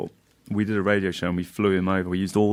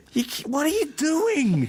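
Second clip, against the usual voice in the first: much higher, slow, put-on indignant voice; mock outrage. Friend acts jealous over a polystyrene wife, shrill mock anger. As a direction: squeal it like a wronged husband, hammed-up. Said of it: "He was like".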